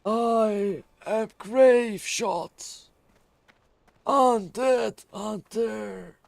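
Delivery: high, very slow character voice; drawn-out heroic intro; roleplay character voice. Acted. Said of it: "Better than grave shit R P".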